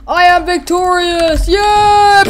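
high, loud victory shout; hammed-up gloating winner; mock triumph. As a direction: proclaim it, ending in a long squealed cheer. Voices winner cheering.

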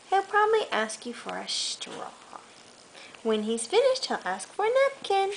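put-on reading to a child. Mother reading a bedtime book to her toddler, gentle storytelling. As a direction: softly read picture-book storytelling. Reads picture book aloud.

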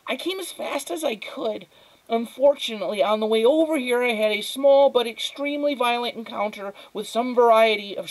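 put-on precocious child, high and fussy; small, high, worried voice; high, thin boy's voice. A narrator's reading. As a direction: explain your injury with earnest drama. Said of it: "His voice was high and thin".

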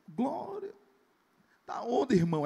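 high, rising "Glória" then eager question; excited praise. Retold or imitated. Pastor imitating a man thrilled his car arrived, excited. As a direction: burst with joy.

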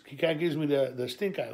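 usual speaking voice

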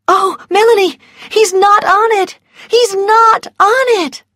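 high, loud cry of joyful relief; elated relief. A narrator's reading. Scarlett tells Melanie that Ashley's not listed, elated cry. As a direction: burst out with the wonderful news.

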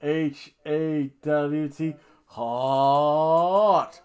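slow, emphatic letters, then a long loud word; hammed-up cheerleader spelling. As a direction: draw out each letter and belt the last word. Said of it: "I'll spell it out for you".

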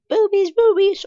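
squeaky loud goofy chant; silly high voice. Pretend. Silly singsong outburst.

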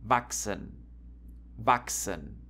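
"wachsen" said as vak-sen; careful "wachsen", chs sounding like x. Shown as a demonstration. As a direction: show "wachsen" with a k-s in the middle. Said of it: "You have to pronounce this like K und S".

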